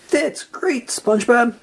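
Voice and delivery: high, delighted praise; excited. A narrator's reading. Patrick excited for SpongeBob, delighted. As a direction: share his joy. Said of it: "Patrick was so excited".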